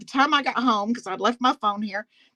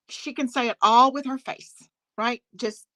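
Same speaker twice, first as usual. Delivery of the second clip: usual speaking voice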